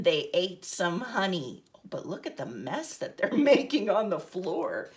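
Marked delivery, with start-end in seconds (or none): none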